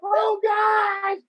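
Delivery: high screamed disbelief, fading to whimpers; overwhelmed fan wailing in mock collapse; tripping out. Fan hearing his idol sing his song, tripping out. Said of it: "Now I'm tripping out".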